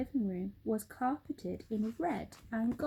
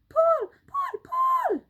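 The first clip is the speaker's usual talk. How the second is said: very high, very loud bellow; yell; 10 dB louder, slower than usual. Acted. Mugglewump yelling at helpers to pull, bellow. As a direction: yell it hauling. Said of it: "yelled Mugglewump".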